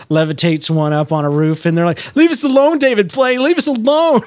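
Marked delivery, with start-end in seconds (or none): 2.1-4.2 high, loud lisping whine. Retold or imitated. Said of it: "they have a lisp".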